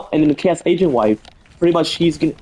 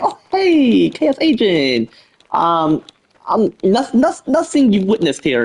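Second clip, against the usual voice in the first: high, put-on nervous greeting with stumbles; 6 semitones higher than usual. Wife hiding a secret from Chaos Agent, nervous. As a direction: act like you're hiding something, pitched up. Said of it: "like, nervously".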